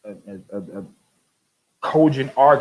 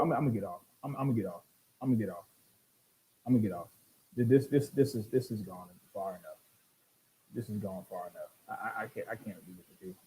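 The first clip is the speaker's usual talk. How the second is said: weary fade-out; 7 semitones lower, 14 dB quieter, faster than usual. Real, not acted. Exasperated signing off.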